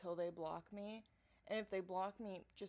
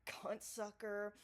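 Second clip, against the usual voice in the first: ordinary delivery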